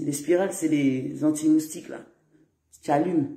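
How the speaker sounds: normal talking voice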